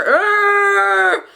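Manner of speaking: high, long creature roar; monster groan. Acted. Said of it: "And then the monster".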